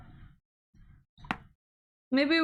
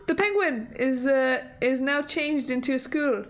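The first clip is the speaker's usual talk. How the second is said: ordinary delivery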